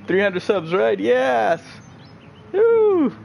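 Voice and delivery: high, rising cheer into a whoop; thrilled, high-pitched whooping; real excited celebration. Real, not acted. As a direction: celebrate a milestone, building to a woo. Celebrating a subscriber milestone.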